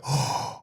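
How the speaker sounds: acted sharp gasp of regret; retold shocked gasp. Child realizing what he just said, horrified gasp. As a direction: suck in breath, acting horrified.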